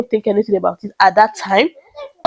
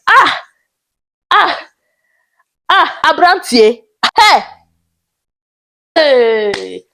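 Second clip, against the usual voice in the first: shocked exclamations; 2 semitones higher, much slower than usual. Real, not acted. Reacting to the man asking her out, stunned cries. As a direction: let out stunned gasping ahs.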